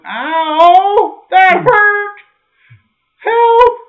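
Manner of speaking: loud, high, desperate yelps; pained cry for help. Pretend. Preacher voicing the injured finger, loud high cries for help. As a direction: shout it out like the finger is crying for help.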